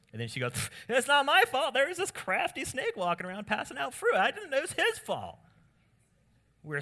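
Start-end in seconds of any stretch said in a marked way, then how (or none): 0.9-5.4 very high, loud mock-female protest; indignant high-voiced blame-shifting; hammed-up Eve. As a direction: squeal the excuse in falsetto.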